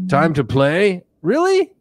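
high, loud mock disbelief; mock surprise. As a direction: act shocked at the invitation, way up high. Mocking Pinhead meeting.

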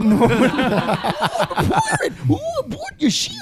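put-on teasing echo of a South African accent, swooping high; mock South African accent, climbing high; mimicked South African pirate.

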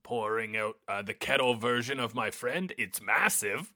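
low, soft voice; subdued commentary. Pretend. Host pours tea from the kettle friend, low and hushed. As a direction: talk quietly through a careful task.

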